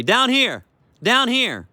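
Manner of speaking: high, loud call down to the dog; dog training call. Shown as a demonstration. Trainer to beagle, high loud calling voice. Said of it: "This is how I train him to my voice".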